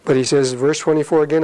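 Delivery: usual speaking voice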